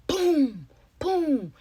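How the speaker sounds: high, sharp boom boom; mock battering-ram booms; imitated booms. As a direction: punch out each boom like a ram hitting the door. Imitating the door being rammed in.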